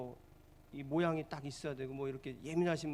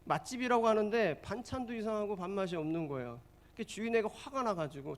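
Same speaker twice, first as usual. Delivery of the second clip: ordinary delivery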